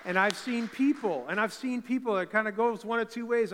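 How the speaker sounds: loud, high preacher's declaration; full-force preaching. Real, not acted. Pastor driving his point home to the church, loud and high. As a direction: preach it out to the room.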